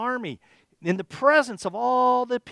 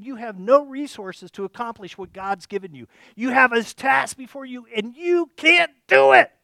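mocking; 3 semitones higher, 8 dB louder than usual. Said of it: "he was making fun of them".

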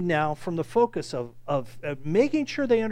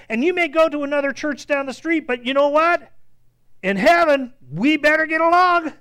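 rising to a loud preacher's peak; preaching climax; 8 semitones higher, 8 dB louder than usual. Real, not acted. Preacher to his congregation, building to a loud climax. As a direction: build the warning about heaven until you're nearly shouting.

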